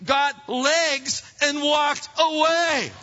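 slow, loud, high sarcastic dad line; acted parental sarcasm at full volume; exasperated dad. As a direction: draw it out loudly like a fed-up father. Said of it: "your dad used on you".

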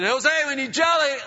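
loud, high holler to a coworker; yelling. Retold or imitated. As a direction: yell across a noisy factory floor. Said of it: "I yelled, uh, Jose's name".

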